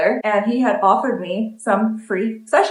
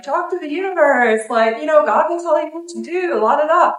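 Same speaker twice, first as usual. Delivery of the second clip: mocking her own advice; 6 semitones higher than usual. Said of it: "I literally sit here on YouTube and telling you guys".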